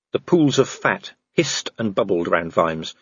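usual speaking voice

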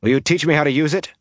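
quick, high-pitched asking; fast, keen youthful question; urgent request. A narrator's reading. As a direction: ask it eagerly in a rush. Youth eagerly asks for teaching.

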